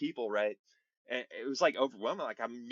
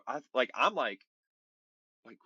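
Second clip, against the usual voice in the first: ordinary delivery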